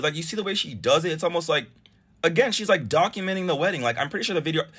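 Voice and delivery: ordinary talk, nothing marked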